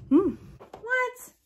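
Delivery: high, startled "What?"; surprised. Real, not acted. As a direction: blurt it out in shock. Startled exclamation.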